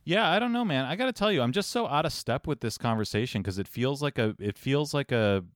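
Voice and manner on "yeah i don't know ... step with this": mock high voice. Sudden high voice.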